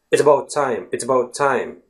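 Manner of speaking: quick, run-together "It's about time", A almost gone; "It's about time" at conversational speed, "about" shrunk; quite fast. Shown as a demonstration. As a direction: rattle off "It's about time" so the schwa nearly vanishes. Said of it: "when you speak quite fast, it almost disappears".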